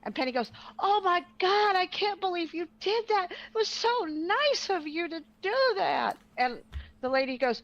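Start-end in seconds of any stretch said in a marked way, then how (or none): 0.8-6.2 high, gushing mock praise of a stranger. Retelling how Penny gushed at the stranger, high and gushing. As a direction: lay on Penny's fake sweetness thick.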